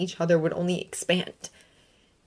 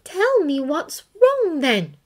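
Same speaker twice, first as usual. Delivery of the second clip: put-on higher, louder relieved young woman; Hermione's voice; 10 semitones higher, 4 dB louder, slower than usual. A narrator's reading. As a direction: call out with relief, pitched up.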